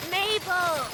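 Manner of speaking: high calling voice from across a lake; high, drawn-out mock call of a woman; distant calling. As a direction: call out for a lost kid. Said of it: "some lady was yelling".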